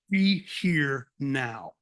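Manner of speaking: very slow, hushed plea to the sky; short prayer. Retold or imitated. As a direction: stretch each word out like a desperate prayer. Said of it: "it came out just like this".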